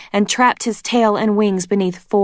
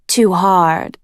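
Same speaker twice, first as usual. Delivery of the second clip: teasing; 4 dB louder than usual. A narrator's reading. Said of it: "Queen Scarlet teased".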